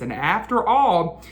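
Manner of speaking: ordinary delivery, nothing marked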